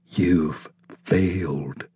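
soft, slow small inner voice; slow hushed little voice. A narrator's reading. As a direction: let a tiny voice drop the verdict quietly. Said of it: "says a little voice".

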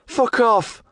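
put-on high, loud young woman shout; yelling lass. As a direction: yell it at the fence. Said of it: "the bird were yelling".

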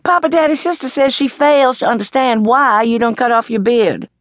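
high, sing-song tattletale; Stella-Rondo tattling to Papa Daddy at dinner. A narrator's reading. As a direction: tell on your sister, all innocence.

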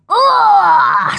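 loud, high drawn-out "aah"; falling yell. A narrator's reading. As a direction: yell as if toppling backwards. Tumbling backwards into water.

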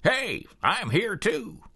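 high, slow, cheerful dog talking; dog's voice. A narrator's reading. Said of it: "as if to say".

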